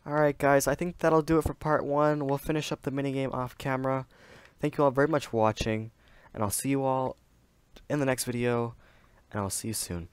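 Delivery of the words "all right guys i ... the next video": upbeat YouTube sign-off; outro voice. Acted. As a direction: sign off to viewers cheerfully. Video outro.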